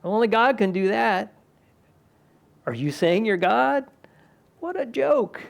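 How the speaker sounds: high mocking scoff; scoffing sneer. Preacher voicing leaders mocking Jesus' claim, high scornful scoff.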